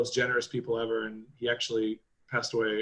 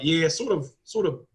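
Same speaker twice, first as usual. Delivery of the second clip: put-on sheepish Kiwi accent; 4 semitones higher than usual. Kiwi friend quoted, sheepish.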